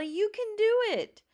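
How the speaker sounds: high, loud encouraging shout; a girl screaming encouragement at her brother. Acted. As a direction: keep screaming your support up at him. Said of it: "screamed Annabelle".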